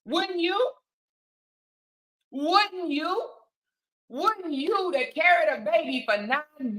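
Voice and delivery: high-pitched, insistent questioning; pointed rhetorical challenge. Real, not acted. Host challenges viewers about the mother's reaction, pointed and insistent. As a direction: throw it out as a rising challenge.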